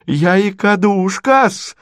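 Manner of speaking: high, drawn-out affronted squeal; indignant squeal. A narrator's reading. Natalya hears herself insulted, squeals indignantly. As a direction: squeal it out in outrage. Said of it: "взвизгнула Наталья Дмитриевна".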